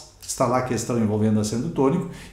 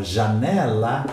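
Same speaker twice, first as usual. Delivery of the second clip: "janela" drawn out, stressed on NE; stress on second-to-last syllable; much slower than usual. Shown as a demonstration. Teacher showing students where tonic stress falls, "janela" stressed on NE. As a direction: lift the voice on the NE of "janela". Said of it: "A minha voz se eleva na penúltima sílaba".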